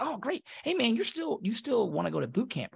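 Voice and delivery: lightly imitated recruiter, high-pitched and breezy; chummy recruiter. Recruiter casually checks on a recruit's plans, chummy. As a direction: start high on the pleasantry, then ease down.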